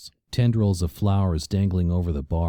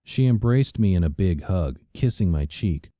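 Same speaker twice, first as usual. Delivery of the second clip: ordinary delivery, nothing marked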